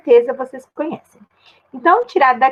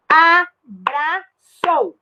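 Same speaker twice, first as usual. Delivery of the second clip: "Abraçou" very slowly as a... bra... çou; teacher's slow syllable split of "Abraçou"; 3 semitones higher, much slower than usual. Shown as a demonstration. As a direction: sound out "Abraçou" with a pause after each syllable. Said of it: "Eu não separei".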